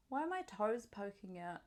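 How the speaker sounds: usual speaking voice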